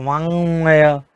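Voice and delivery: वाङ्मय said slowly, ङ then म distinct; slow model word. Shown as a demonstration. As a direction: repeat वाङ्मय slowly for the class. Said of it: "यहाँ अं और म दो पंचम वर्ण आए". (वाङ्मय धीमे उच्चारण में)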